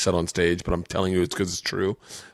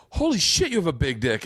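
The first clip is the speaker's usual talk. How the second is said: shocked; 6 semitones higher than usual. Retold or imitated. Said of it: "and I went".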